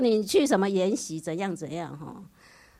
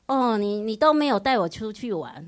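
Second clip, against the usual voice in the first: put-on louder, aggrieved teenage whine; whiny complaint; 3 semitones higher, 8 dB louder than usual. Daughter complaining to her mother about no holiday. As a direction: push the grievance at mom.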